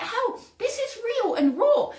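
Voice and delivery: high, slow, laughing outburst of glee; excited exclamation. Retold or imitated. Host retelling her thrill to viewers, high laughing exclamation. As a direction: throw it up high, half laughing.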